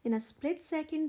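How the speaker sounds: usual speaking voice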